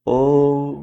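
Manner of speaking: "ओ" low and level, melting into m; sustained Om. Shown as a demonstration. Teacher asking "don't we say it so", "ओ" held low. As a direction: hold "ओ" on one low note into a hum. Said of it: "ऐसे बोलते हैं ना".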